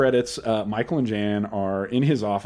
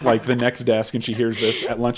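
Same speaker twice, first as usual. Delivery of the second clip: ordinary talk, nothing marked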